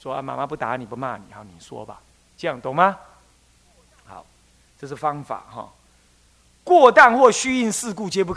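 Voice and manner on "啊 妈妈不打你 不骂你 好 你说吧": soft, easy coaxing, trailing quieter; calm mother promising no punishment; relaxed reassuring mother. Shown as a demonstration. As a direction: gently coax a child to confess. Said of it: "你要给他放轻松的".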